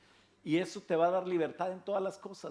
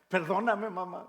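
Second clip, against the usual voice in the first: tearful plea; 3 semitones higher, 6 dB louder than usual. Retold or imitated. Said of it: "Y lloraba y decía".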